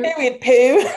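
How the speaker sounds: high, loud echo breaking into laughter; laughing mimicry. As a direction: parrot the catchphrase and crack up. Echoing the catchphrase, laughing.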